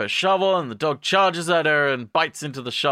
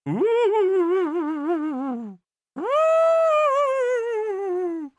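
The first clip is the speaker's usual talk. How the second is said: long, very high sustained hoot; mock spooky high wail; eerie "ooh". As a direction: hold a thin high note for suspense. Imitated eerie sound for turning.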